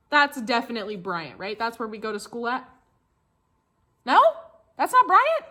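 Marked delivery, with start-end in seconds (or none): 4.1-5.4 high, loud fake disbelief; mock surprise. Teacher pretending to mix up pictures, high mock shock. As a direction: act shocked it isn't the school.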